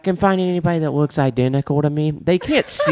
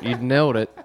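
normal talking voice

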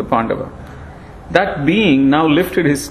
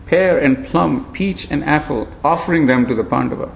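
ordinary delivery, nothing marked

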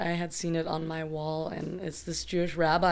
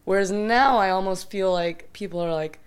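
ordinary talk, nothing marked

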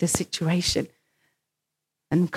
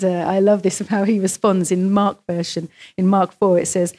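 ordinary delivery

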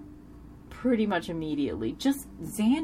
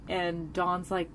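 ordinary talk

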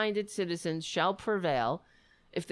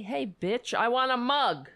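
rude demand; 5 semitones higher, 4 dB louder than usual. Pretend. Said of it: "Maybe say it nicer than that".

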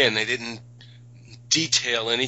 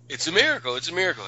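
higher, imitated cry of wonder; mock amazed exclamation; 6 semitones higher, much faster than usual. One guy in the congregation stands up, mock amazed. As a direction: play the awestruck churchgoer lightly.

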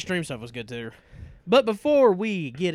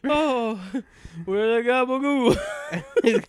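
laughing; 11 semitones higher, 5 dB louder, much slower than usual. Pretend. Laughing meme reference.

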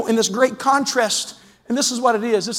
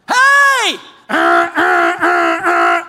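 mock road rage; 6 dB louder, much slower than usual. Preacher acting out yelling at slow driver, hammed-up road rage. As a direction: blast it like a furious horn.

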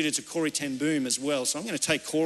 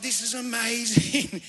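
slow, drawn-out delight, pitched up; stretched, higher-pitched marvelling; retold amazement; 5 semitones higher, slower than usual. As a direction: act out a proud dad's wonder. Father retelling his delighted reaction.

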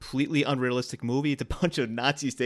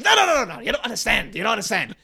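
loud, high, frantic mad scientist; Doc Brown impression; 9 semitones higher, 6 dB louder than usual. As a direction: warn him like a panicked Doc Brown. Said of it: "another Christopher Lloyd".

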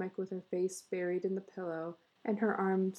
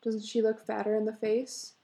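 usual speaking voice

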